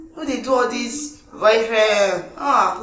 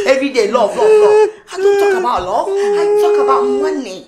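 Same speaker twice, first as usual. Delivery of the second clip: loud and high, mocking love; love mocked in a rising shout; mocking rant; 8 semitones higher, 6 dB louder than usual.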